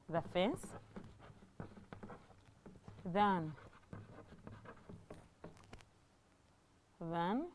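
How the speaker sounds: soft, halting word-by-word dictation; dictating while writing. Real, not acted. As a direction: murmur it piece by piece as you write, long pauses. Writing the sentence out while saying it.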